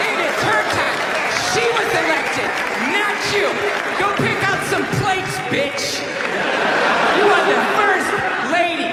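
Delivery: high, hammed-up furious yelling; mock angry yell. Comic imitating feminists yelling at the first male first lady, hammed-up high shouting. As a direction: ham up a shrieking scold.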